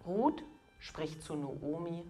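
ordinary talk

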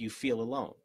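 ordinary talk, nothing marked

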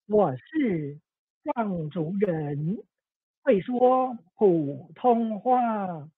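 put-on very slow, drawn-out little girl voice; little girl voiceover. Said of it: "I will be doing the, um, voiceover".

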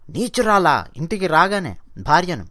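ordinary talk, nothing marked

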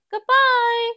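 high, bright little mouse voice for Maisie; Maisie's voice. Acted. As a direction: squeak a cheerful goodbye as the small heroine. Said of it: "Then she says goodbye to Cyril".

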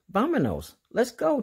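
rally everyone to set off; cheering. Acted. Dora cheering her friends on to set off, a high, rising cheer. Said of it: "cheered Dora".

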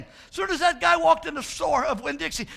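normal talking voice

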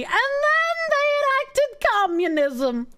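high, slow ominous mockery; mock dramatic doom. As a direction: announce it like a grand tragedy.

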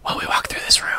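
hushed, breathy whisper of plot secrets. Real, not acted. Gamer whispering to viewers while sneaking through a room. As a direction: whisper it so nobody nearby hears. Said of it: "we're gonna whisper".

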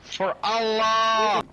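slow, high mock war cry; mock battle cry. Mock battle cry before jumping.

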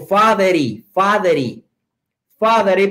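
fathery, with the R of father running straight into is; fathery with an English accent, R linked to the vowel. Shown as a demonstration. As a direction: say fathery as one smooth word, English R. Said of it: "pero con acento inglés".

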